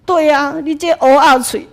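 loud, laughing jinx jab; laughing accusation. Retold or imitated. Coworker teasing the speaker as a jinx, laughing loudly. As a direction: tease her as a jinx through laughter.

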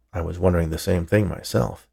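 usual speaking voice

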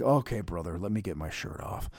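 lazy, low, very soft wrestler mumble; worn-out imitation, deep and barely bothering; Hulk Hogan. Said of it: "like, a lazy Hulk Hogan".

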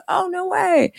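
retelling a thrilled no way to her husband; delighted disbelief. Retold or imitated. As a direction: squeal it in happy disbelief. Hearing product call in hospital.

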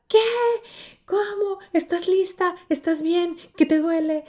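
imitating alarmed sisters, pitched high; mock panicked questions from the sisters; worried sisters. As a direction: sisters crowding in, half-acted alarm. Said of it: "Todas preocupadas".

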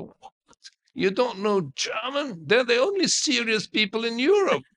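high-pitched, incredulous imitation of an old prince; imitating shocked prince. Elderly prince aghast that the student knows no German, high incredulous imitation. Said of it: "He said".

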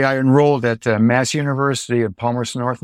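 ordinary delivery

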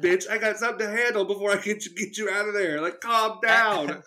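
hammed-up fed-up telling-off; mock exasperated scolding. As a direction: scold a panicking person, stretching the end. Acts out scolding the screaming victim.

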